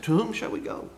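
ordinary delivery

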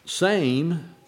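"Same" lifted high and set apart; word held up. Shown as a demonstration. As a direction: hold up "Same" on its own. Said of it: "the word same in the, uh, text".

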